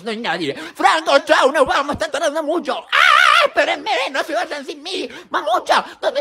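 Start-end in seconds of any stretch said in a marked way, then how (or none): 2.9-3.5 loud high yell; high scream. Pretend. Left behind by the family, loud high yell.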